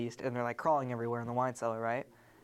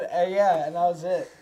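ordinary talk

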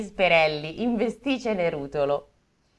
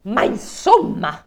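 indignant king; 5 semitones higher, 7 dB louder, slower than usual. Acted. King of steel, indignant remark.

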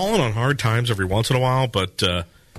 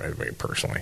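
unvoiced, much softer afterthought; whispered aside; 10 dB quieter, faster than usual. Real, not acted. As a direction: drop to a whisper, just for yourself.

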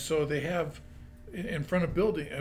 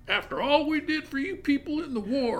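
put-on Texan accent; 12 semitones higher, 7 dB louder than usual. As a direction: imitate an angry Texan tourist. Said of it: "in his deepest Texan accent".

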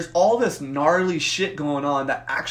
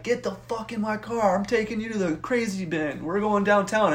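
higher-pitched, worked-up command; frantic ordering; 6 semitones higher than usual. Retold or imitated. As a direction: retell yourself frantically ordering someone around. Ordering the kid into car.

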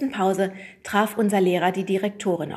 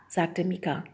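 ordinary delivery

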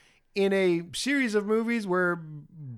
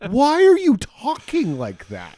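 baffled protest; 5 semitones higher, 5 dB louder than usual. Retold or imitated. Host challenges the TV character's odd talk, loud and exasperated. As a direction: blurt it out in exaggerated bewilderment.

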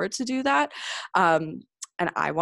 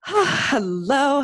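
excited greeting; 9 semitones higher, 6 dB louder than usual. Real, not acted. Host opens the show excitedly.

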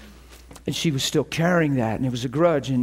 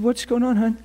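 higher voiced exchange; 8 semitones higher than usual. Phone conversation voices.

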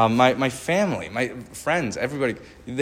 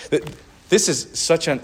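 ordinary talk

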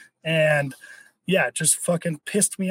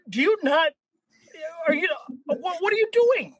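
sputtering, high-pitched incredulity; stammer, then pitch way up in disbelief; acted bafflement at a clueless driver; exasperated disbelief; 7 semitones higher than usual. Acted disbelief at left-lane drivers.

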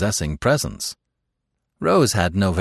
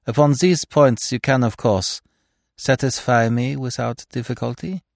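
raised, eager, polite plea; earnest painter voice; 6 semitones higher, 3 dB louder than usual. Acted. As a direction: lift the voice, earnestly courteous. Gerard addresses stranger earnestly.

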